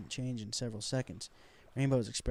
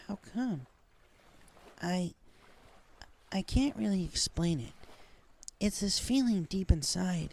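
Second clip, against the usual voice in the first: higher, halting pony voice with long pauses; hesitant Rainbow; 6 semitones higher than usual. A narrator's reading. Rainbow confessing to Applejack, higher halting voice.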